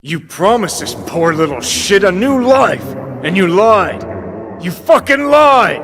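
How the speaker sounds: rising to a high, loud furious yell; furious accusation. Acted. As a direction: build from anger to a full scream. Accusing Alt of lying.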